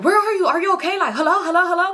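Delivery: phoning the missing friend, frantic and high; frantic worry. Retold or imitated. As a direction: call out in panic.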